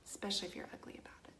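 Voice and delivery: quick whispered aside; fast whisper. Real, not acted. Whispering quickly to viewers about rude askers. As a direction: slip it out under her breath.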